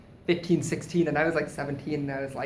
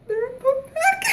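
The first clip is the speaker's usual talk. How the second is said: very high, squeaky, laughing; panicked squeaky excuse; much slower than usual. Retold or imitated. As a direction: squeak it out, flustered and crying. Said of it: "my voice was all squeaky".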